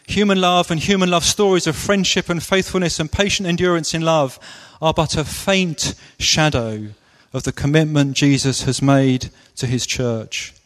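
ordinary talk, nothing marked

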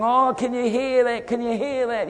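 high-pitched mock excitement; excited. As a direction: imitate thrilled onlookers, voice pitched right up. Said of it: "they were all excited going".

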